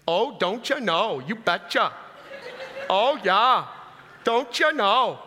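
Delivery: hammed-up Minnesota accent with long round O's. Shown as a demonstration. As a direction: lay on a folksy Minnesota accent. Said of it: "we have the coolest accent".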